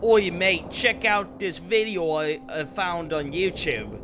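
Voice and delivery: hammed-up Cockney British accent; mock British accent. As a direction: toss it off like a London lad. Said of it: "Not gonna be like".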